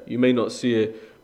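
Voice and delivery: normal talking voice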